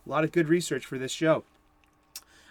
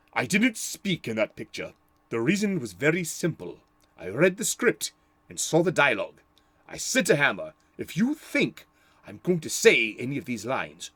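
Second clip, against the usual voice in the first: Christopher Lee impression. Quoting Christopher Lee's refusal to Hammer, lightly done in his English accent. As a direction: quote him in a mild Christopher Lee accent, each line deliberate. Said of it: "best Christopher Lee accent".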